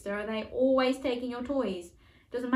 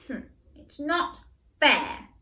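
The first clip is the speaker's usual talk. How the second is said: cross voice; 6 semitones higher, 4 dB louder, slower than usual. Shown as a demonstration. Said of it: "show me a very cross face".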